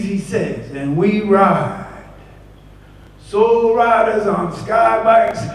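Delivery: put-on high, loud poem in Jamaican accent. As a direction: ride the words with Jamaican swagger. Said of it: "heavy Jamaican accent".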